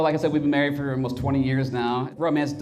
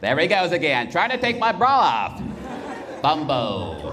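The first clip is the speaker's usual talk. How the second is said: NFL announcer; 4 semitones higher than usual. Retold or imitated. Wife calling his moves like an NFL announcer. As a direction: narrate the bra attempt like a big football play. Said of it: "sound like an old school N F L announcer".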